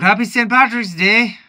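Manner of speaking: high, loud, bouncy hello; cheery holiday greeting. Acted. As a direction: cheer it out to the viewers. Festive greeting in costume.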